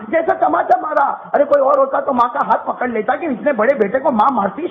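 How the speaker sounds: ordinary talk, nothing marked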